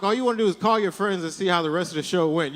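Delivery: usual speaking voice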